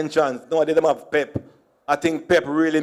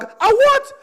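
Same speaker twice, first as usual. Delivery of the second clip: very high baffled question; puzzled; 4 dB louder than usual. Retold or imitated. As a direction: squeak it, baffled. Puzzled store lady.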